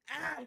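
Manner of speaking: high mock yell from a meme; short, high-pitched imitation of a screaming guy; imitated scream. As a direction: yelp like the man in the meme. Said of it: "it faded out while he was screaming".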